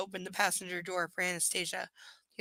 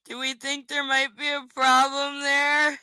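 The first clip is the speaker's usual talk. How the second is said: higher, louder, slow mock concern; sarcastic; 6 semitones higher, 6 dB louder, slower than usual. Real, not acted. Asking viewers, sarcastic and drawn out. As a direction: ask it with heavy irony.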